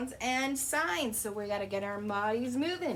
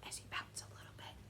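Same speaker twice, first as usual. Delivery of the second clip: whispered aside; 16 dB quieter, much faster than usual. Real, not acted. Whispered aside during demo.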